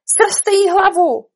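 high, loud, drawn-out royal shout; full-voiced scream. Queen orders Alice beheaded, screaming. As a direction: yell the order slowly at full voice. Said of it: "rozkřikla se královna, co jí hlas stačil".

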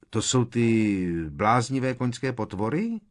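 ask, unsure what is there; tentative query rising at the end; uncertain. A narrator's reading. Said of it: "zeptal se nejistě Ron".

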